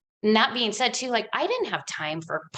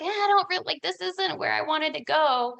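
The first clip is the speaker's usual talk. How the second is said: mock doubtful inner voice; 9 semitones higher than usual. Quoting her own inner doubt.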